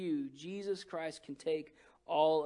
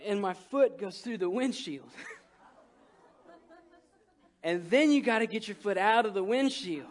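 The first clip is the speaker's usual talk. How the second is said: ordinary talk, nothing marked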